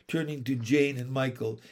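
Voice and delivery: plain reading voice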